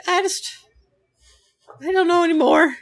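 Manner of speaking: high, helpless whine; squeaky despairing little voice; defeated Jesper. Acted. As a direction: whimper in resignation. Jesper gives up.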